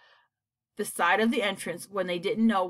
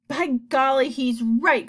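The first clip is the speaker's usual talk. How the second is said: louder, raised exclamation; mildly startled outburst; excited cry; 5 semitones higher, 5 dB louder than usual. A narrator's reading. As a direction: cry out in sudden agreement. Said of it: "cried the centipede".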